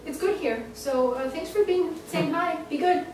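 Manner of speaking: breezy and distant, as from beyond; voice of the dead boyfriend. Acted. Medium voices the dead man.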